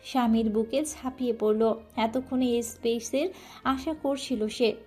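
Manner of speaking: plain reading voice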